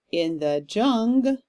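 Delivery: "In the jung," slow, with the weight on "jung"; stressed syllable. Shown as a demonstration. Teacher marking the stressed syllable for students, slow and deliberate. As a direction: lean hard on "jung" and drop the rest. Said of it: "jung part of jungle getting the more stress".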